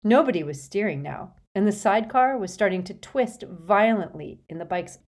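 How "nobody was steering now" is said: ordinary delivery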